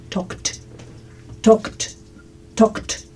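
"talked" as one syllable, "tokt"; silent L, final T. Shown as a demonstration. Teacher showing students how the past sounds, "talked" as "tokt". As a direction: softly repeat "talked" with no L and a crisp T ending. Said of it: "Y así suena".

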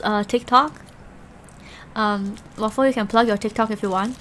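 ordinary delivery

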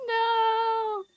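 high, loud cry of defeat; anguished shout. Real, not acted. Player losing the cutting minigame, shouting no. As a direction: yell no as it fails.

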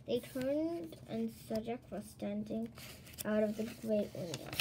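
plain reading voice

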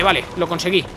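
ordinary delivery

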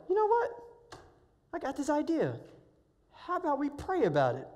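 high, bright-eyed dad pitching an idea; dad's idea voice. Retold or imitated. Dad suggests to his son they pray, high eager voice. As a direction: voice the dad lifting up with a fresh idea. Dad proposes praying at ridge.

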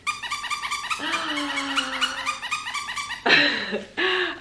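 high delighted gasp; big playful gasp. Real, not acted. Mother gasping to amuse her baby, high and playful.

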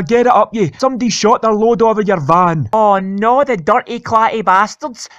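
ordinary delivery

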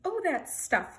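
loud, high, amused dismissal; laughing. A narrator's reading. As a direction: laugh it off breezily. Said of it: "laughed the captain".

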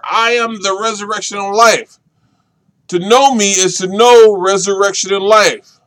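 loud, ringing declaration; emphatic proclamation. Acted. Preacher booming Jesus' words to Martha, loud. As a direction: ring it out like a pulpit climax. Sermon climax, emphatic quote.

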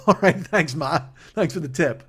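sarcastic thanks. Retold or imitated. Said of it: "And I go".

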